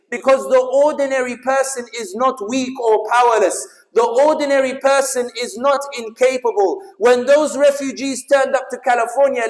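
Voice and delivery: loud, high rallying oratory, stretching key words; rally the crowd with forceful emphasis; impassioned speech. Real, not acted. Speaker urging the crowd that they brought the change, impassioned oratory.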